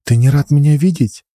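teasing pouty question; bossy little princess. Acted. Alina voiced as coy girl.

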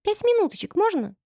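put-on very high pleading child; begging little girl. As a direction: wheedle like a small girl. Girl begging more time.

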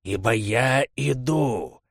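slow, otherworldly warning; menacing monster, drawn out; eldritch horror. Acted. Существо объявляет о нападении. (медленное зловещее предупреждение чудовища)

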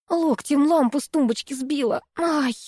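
lightly acted annoyed friend; mildly annoyed. A narrator's reading. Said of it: "рассерженно проговорила Аня".

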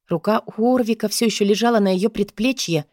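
usual speaking voice

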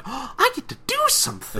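very high, gleeful cartoon voice; excited Pinky squeal. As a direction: gasp and squeal with delight. Voicing Pinky's delight.

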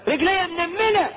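high, lightly voiced moan about numb legs; mock whiny complaint. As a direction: play a fussy complainer, half-hearted. Someone complains his legs are numb.

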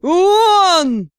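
loud high yell. A narrator's reading. Director howls in rage in his office, loud yell. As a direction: let out an enraged howl. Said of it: "кабинет потряс вопль".

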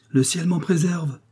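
lightly voiced lady's outcry; exclaiming. A narrator's reading. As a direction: throw up your hands, heaven forbid. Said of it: "s'écria-t-elle".

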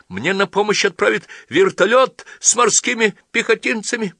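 high, loud indignant tirade; outraged yelling. A narrator's reading. Insulted young man threatens the priest with his country, outraged. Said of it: "завопил Джон".

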